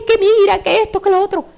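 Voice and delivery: high, loud distressed pleading; anguished mother. Retold or imitated. Grieving mother pouring out her grief to the prophet, high and loud. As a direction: cry out like a broken mother.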